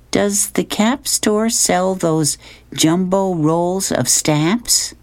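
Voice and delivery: high, slowed camper's question; Luann. Acted. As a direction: ask it in a lifted girl camper's voice. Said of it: "Luann says".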